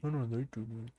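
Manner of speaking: stiff flat corpse mumble; zombie voice. Acted. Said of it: "is that your zombie impression".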